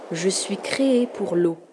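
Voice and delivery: slow, measured riddle-giving voice. A narrator's reading. The sea poses its riddle to two children, slow and deliberate. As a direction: draw the riddle out slowly.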